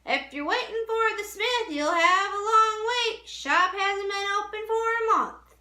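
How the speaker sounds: high, loud call across the street. A narrator's reading. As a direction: shout it over to the boy from a distance. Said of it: "called out to him".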